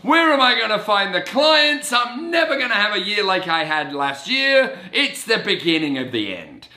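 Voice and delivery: mock despair at starting the year over; panicked inner voice. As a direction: wail it like it's all going to collapse. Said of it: "that used to be my default setting".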